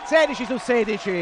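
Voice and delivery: loud rising call of the score; excited shout. Real, not acted. Perfect score called out loud.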